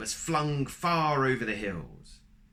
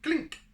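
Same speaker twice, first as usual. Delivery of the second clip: high clink sound; 5 dB louder than usual. Acted. Egg sound effect.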